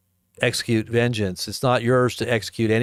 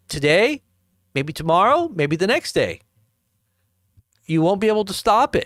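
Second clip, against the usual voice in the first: higher mock Putin with rising lilts; mock his casual threat; lightly voiced smug Putin; imitating Putin; 8 semitones higher than usual. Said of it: "that's the way he said it".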